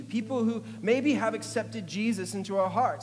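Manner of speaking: loud, high, rising preaching. Acted. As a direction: build it up like a revival preacher. Preaching raised voice.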